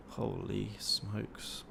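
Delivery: slow, hushed holy smokes, drawn right out; awed. Real, not acted. Streamer watching his trade hit profit, hushed slow awe. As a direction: let it out slowly in quiet disbelief. Trade running to target live.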